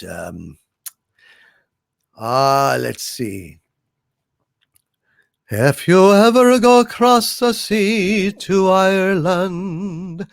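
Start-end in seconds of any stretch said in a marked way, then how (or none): 5.5-10.2 put-on slow, crooning Irish accent; sing-song Irish lilt, slowly. Asked by a viewer for an Irish accent, slow Irish lilt. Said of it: "Do your Irish accent, please".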